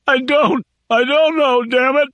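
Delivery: high, desperate crying; crying denial. Acted. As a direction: wail it in despair. Sobbing prisoner denies.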